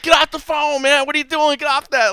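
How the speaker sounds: high-pitched, hammed-up annoyance; mock irritated yell. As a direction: pretend to yell at an eavesdropping sibling. Acted yelling at phone eavesdropper.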